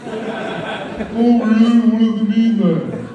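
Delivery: put-on high, slow, laughing girlfriend voice; sassy mocking girlfriend voice; girlfriend impression. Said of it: "I do that impression of my girlfriend".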